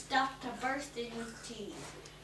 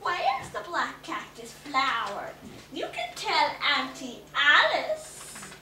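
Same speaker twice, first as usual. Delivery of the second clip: sweetly hissed; 2 semitones higher, 8 dB louder than usual. A narrator's reading. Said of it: "Then she sweetly hissed".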